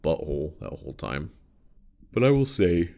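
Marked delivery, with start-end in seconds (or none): 2.1-2.9 said through a yawn. Real, not acted. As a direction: let a yawn stretch the words. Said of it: "I keep yawning".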